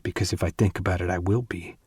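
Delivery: ordinary delivery, nothing marked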